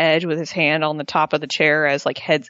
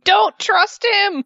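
high, drawn-out mock scream; imitated scream; 13 semitones higher, 4 dB louder, much slower than usual. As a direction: yell a high warning at the screen. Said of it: "we're sitting here screaming".

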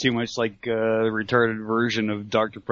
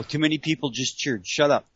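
ordinary talk

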